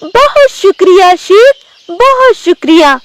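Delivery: high-pitched, grateful tigress; आभारी बाघिन. Acted. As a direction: thank him in a high, put-on voice. बाघिन शेर को धन्यवाद देती. (ऊँची आवाज़ में आभारी बाघिन)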